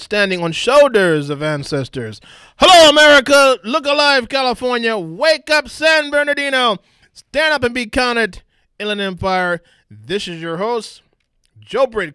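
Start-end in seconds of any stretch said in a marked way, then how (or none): 2.6-9.6 hype shout. Acted. Host hyping up listeners, calling out.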